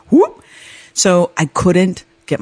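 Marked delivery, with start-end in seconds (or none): 0.1-0.4 quick rising "whoop"; imitated sinking whoop. Skier shows non-skiers sinking into snow, imitated whoop. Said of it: "You just go".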